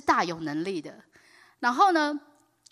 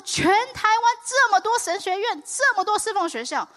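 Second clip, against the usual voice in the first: mother's tearful plea; 12 semitones higher, 6 dB louder than usual. Retold or imitated. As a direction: cry out the complaint, half-sobbing. 我妈就跟神哭啊.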